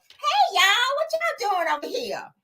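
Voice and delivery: imitating a friend arriving poolside, high and slow; Mel's voice. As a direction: drawl it sweetly up high. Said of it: "I gotta say it in her voice".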